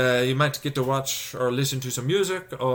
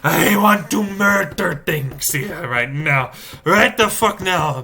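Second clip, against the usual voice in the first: louder, slow and deliberate rage; slow angry outburst; 5 semitones higher, 7 dB louder, slower than usual. Real, not acted. Venting to viewers about the commute, slow and angry. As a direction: spit out each word with fury. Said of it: "I'm so angry".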